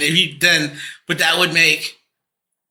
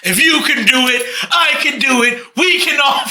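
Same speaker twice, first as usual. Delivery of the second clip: high, shouted mock rallying cry; hammed-up movie boxer addressing a crowd; Rocky's motivational speech; 7 semitones higher than usual. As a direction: build higher with each phrase like a corny speech. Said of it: "worst motivational speech I've ever seen".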